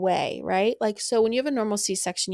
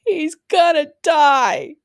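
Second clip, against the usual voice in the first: despairing cry; 7 semitones higher, 9 dB louder, much slower than usual. Retold or imitated. First words on hearing it's a boy.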